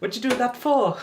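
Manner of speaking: high, loud sing-song Welsh; hammed-up Welsh telling-off; mock Welsh accent. Streamer mimicking Fireman Sam, mock Welsh accent. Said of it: "That's just taking the mick".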